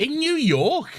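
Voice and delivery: high incredulous question; hammed-up disbelief; mock astonishment. Voicing astonished American shop staff.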